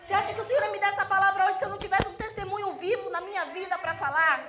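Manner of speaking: loud, emphatic sermon delivery; fervent testimony from the pulpit; forceful preaching. Real, not acted. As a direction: drive each point home to the congregation.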